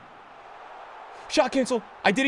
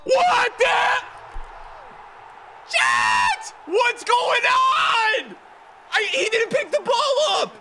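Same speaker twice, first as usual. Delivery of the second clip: berserk rage; 9 semitones higher, slower than usual. Real, not acted. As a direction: yell at the screen, losing it. Keeper blunder, raging disbelief.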